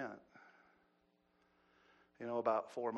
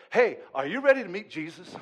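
loud, raised preacher's challenge; calling out; 6 semitones higher, 14 dB louder than usual. Acted. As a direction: call out to the whole church. Preacher acts out challenge to people.

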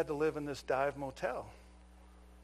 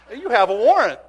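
announcing the warrant; 7 semitones higher, 16 dB louder than usual. Retold or imitated. Cop announcing the arrest.

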